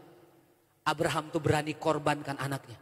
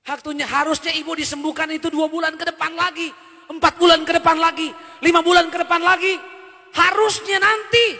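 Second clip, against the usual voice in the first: raised, higher, louder preaching push; preaching at full force; 11 semitones higher, 12 dB louder than usual. Acted. Pastor preaching to the church, loud and higher. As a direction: drive the point home to the congregation.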